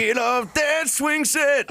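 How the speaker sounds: high, drawn-out shout; shouted lyric. Pretend. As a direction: belt the line at full volume.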